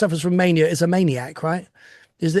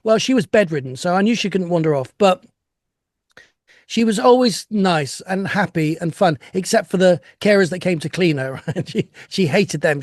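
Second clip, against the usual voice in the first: ordinary delivery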